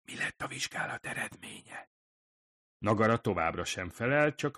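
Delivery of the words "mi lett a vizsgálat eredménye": hushed, soft whisper; lowered voice. A narrator's reading. Hirai asks Nagare about test results, hushed whisper. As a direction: whisper a delicate question discreetly. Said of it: "még inkább lehalkította a hangját".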